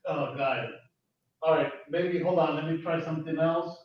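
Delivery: distant, echoing bad mic; cave echo. Real, not acted. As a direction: voice from far off in a cave. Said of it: "it sounds the exact same".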